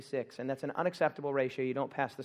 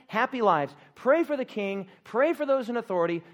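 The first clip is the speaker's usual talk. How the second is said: ordinary delivery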